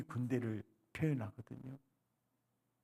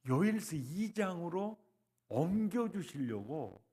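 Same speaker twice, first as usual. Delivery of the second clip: ordinary talk, nothing marked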